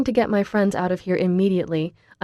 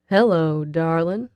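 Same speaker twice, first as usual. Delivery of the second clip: slow sexy drawl; slower than usual. Acted. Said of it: "he says with a slow, sexy drawl".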